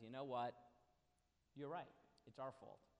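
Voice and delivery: low, soft, resigned concession; quiet admission. Retold or imitated. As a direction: drop the voice and own up to it. Prophet turns to God, admits fault.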